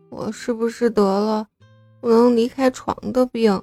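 weak, drained, whimpering; feeble whining. A narrator's reading. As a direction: moan it out limply. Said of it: "有气无力地哼唧道".

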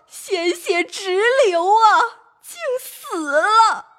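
drawn-out narration of a death; slowing down on the tragedy; slow, grave. A narrator's reading. Storyteller slowing down for the girl's death.

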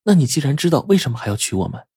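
mildly astonished question, starting high; lightly acted puzzled woman; puzzled surprise. A narrator's reading. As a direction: ask with a touch of surprise. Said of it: "燕儿就诧异".

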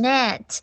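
"net" with a short open eh instead of ee; wrong short vowel in "net"; mispronounced vowel. Shown as a demonstration. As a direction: imitate the slip, eh in "net". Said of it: "然后读成net".

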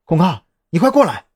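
alarmed call, not very loud; pressing, startled summons; urgent exclamation. A narrator's reading. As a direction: call him over urgently, volume held back. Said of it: "就听到张扬的惊呼".

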